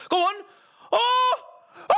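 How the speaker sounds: high, loud gasping shout of hope; excited shout. Real, not acted. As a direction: cry out as the chance builds. Near miss on goal.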